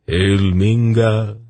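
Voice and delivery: slow, submissive hail; cowed acclaim. A narrator's reading. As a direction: hail him slowly, giving in at last. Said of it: "said at last, cowed finally into submission".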